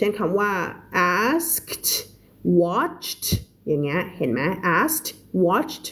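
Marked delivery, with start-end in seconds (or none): 1.2-3.2 "asked" and "watched" ending in a crisp T. Shown as a demonstration. Teacher showing past-tense endings, clear T on "asked" and "watched". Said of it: "เป็น T ก็คือ". 4.8-5.9 "asked", "watched" each closed with a T. Shown as a demonstration. Teacher repeating the examples for learners, T endings on "asked" and "watched". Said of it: "งั้นก็จะเป็น T".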